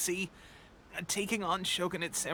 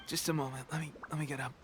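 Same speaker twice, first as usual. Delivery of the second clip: weak, strained whisper; 3 semitones lower, much faster than usual. Acted. Teppei trying to get out of bed, weak strained whisper. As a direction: speak as if too weak to sit up. Weak soldier struggling to rise.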